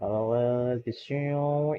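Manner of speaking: ordinary talk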